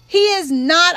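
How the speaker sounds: loud angry roar. A narrator's reading. Girl roaring at her cousin in denial. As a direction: roar it back at him. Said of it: "I roared".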